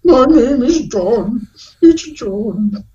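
high, slow mock-submissive voice; drawn-out, high-pitched surrender; broken Roots captive. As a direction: give in like a parodied captive. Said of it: "are you doing a Roots reference?".

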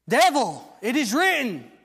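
loud, high, ringing proclamation; preaching at full force. Acted. Preacher to congregation, loud ringing proclamation. Rebuking the devil.

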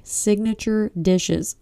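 slow, careful "Signature dishes" with each syllable spelled out; slowly nail "Signature dishes" this time; careful retake. Shown as a demonstration. Podcaster retrying a phrase she kept fumbling, slow and careful. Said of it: "so hard for me to say".

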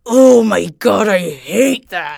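loud, slow groaning complaint; exasperated groan. Retold or imitated. Retelling her reaction to her brother, a loud slow groan. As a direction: drag it out in annoyance. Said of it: "It's like".

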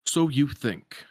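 slow, menacing villain line; a lightly voiced sinister taunt. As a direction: Zaldin taunting the heroes from the shadows. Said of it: "then we hear Zaldin".

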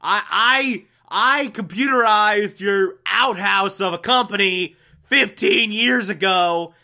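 a hammed-up furious phone rant; retold angry yell. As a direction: bark it down the phone in mock fury. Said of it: "gets pissed off and yells at him".

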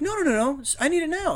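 high-pitched insistent protest; mock urgent pleading. Host mimics ex demanding it from scammer, urgent. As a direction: imitate her pressing for it now.

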